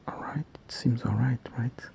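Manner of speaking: soft whispered check; whispering. Real, not acted. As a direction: whisper it to yourself. Whispers while checking the knot.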